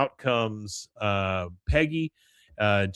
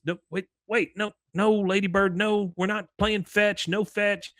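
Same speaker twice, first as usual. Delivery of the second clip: much higher-pitched voice scolding a pet; woman correcting dog; 10 semitones higher than usual. Retold or imitated. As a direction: imitate an exasperated dog owner.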